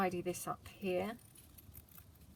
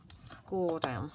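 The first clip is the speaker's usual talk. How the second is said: whispering; 3 semitones lower than usual. Real, not acted. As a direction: whisper to herself. Whispers while working.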